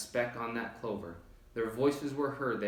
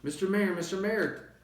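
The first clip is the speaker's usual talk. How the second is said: high, louder call to a tiny friend far off; 8 semitones higher, 3 dB louder than usual. A narrator's reading. Elephant calling down to the tiny mayor, loud and high. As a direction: call up to him urgently. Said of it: "called Horton".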